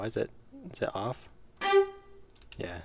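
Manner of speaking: ordinary talk, nothing marked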